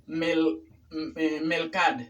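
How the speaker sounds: "melcad" with L for R and no final o; "melcad" cut short after the d; learner's error. Retold or imitated. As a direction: imitate the mistaken "melcad". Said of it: "pero no es melcad".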